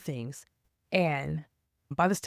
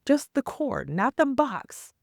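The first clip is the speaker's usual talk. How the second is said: higher, slow, incredulous stress; indignant emphasis; 8 semitones higher, slower than usual. Real, not acted. Complaining to viewers about paying fifty dollars for just a cord, incredulous and slow. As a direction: pitch it up in disbelief, slowly.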